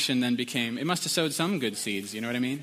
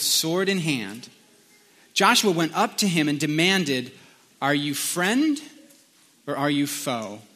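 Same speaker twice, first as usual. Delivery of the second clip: scripture reading; 3 semitones higher than usual. A narrator's reading. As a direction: read the passage aloud with measured weight and pauses. Reads scripture aloud.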